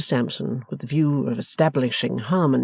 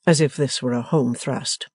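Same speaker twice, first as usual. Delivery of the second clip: ordinary delivery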